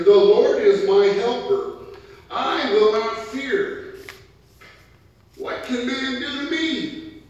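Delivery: loud and high, each phrase proclaimed; bold declaration. Acted. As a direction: proclaim it like a psalm of courage. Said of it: "So he says here".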